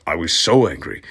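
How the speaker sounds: ordinary talk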